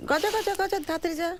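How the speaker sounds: high-pitched, insistent call; urgent calling out. Acted. Calls the boy to hurry. (উঁচু গলায় তাড়া দিয়ে ডাকা)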